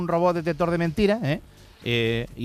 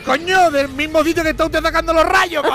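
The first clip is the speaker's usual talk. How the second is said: fed-up captain; 11 semitones higher, 5 dB louder than usual. Acted. Captain loses patience.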